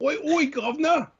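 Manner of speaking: high, mock cockney voice; lightly done London accent. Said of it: "I'm from the U K".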